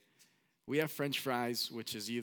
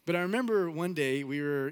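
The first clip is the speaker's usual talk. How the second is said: normal talking voice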